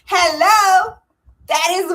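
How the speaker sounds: loud, high exclamation of the obvious; as if it couldn't be clearer; mock disbelief. Real, not acted. Host tells viewers the answer is obvious, loud and high.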